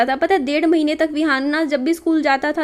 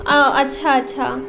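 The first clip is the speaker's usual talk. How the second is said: higher, coaxing questions to a sleepy son; 5 semitones higher, 5 dB quieter than usual. Real, not acted. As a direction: gently coax the little one back to sleep. जागे बेटे से पूछती माँ. (जागे बेटे विहान से माँ, ऊँची कोमल आवाज़ में)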